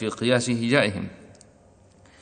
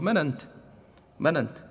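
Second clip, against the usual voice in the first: colloquial Arabic; 6 semitones higher, 3 dB quieter, faster than usual. Retold or imitated. Teacher imitating how ordinary Arabs talk. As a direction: imitate the everyday dialect way of asking. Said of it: "العامي في لهجته يقول".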